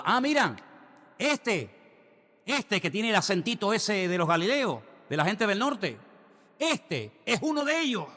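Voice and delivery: high, mocking girl's voice; accusing girl. Servant girl exposes Peter before everyone, high and mocking. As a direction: point him out to the crowd, shrill and teasing. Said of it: "le dice una chica".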